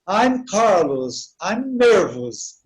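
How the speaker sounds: slow, drawn-out English example phrases; slow example sentences. Shown as a demonstration. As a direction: model each English phrase slowly for beginners. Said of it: "Que aí eu falo". (frases de exemplo em inglês, bem devagar)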